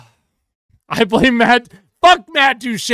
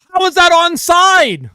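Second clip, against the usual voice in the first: higher, raised disbelieving complaint; indignant yelling; outraged rant; 9 semitones higher, 4 dB louder than usual. Real, not acted. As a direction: protest the ruling to the screen. Still ranting at the call.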